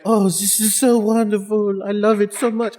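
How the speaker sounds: lightly hammed-up French accent in a dreamy wedding fantasy; mock French accent. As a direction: swoon like Usagi in a fake French accent. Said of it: "talks in a French accent".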